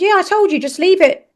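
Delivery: high, mock-smarmy ex voice. Mother mimicking her dismissive ex, lightly mocking. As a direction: mimic him, patronising and high.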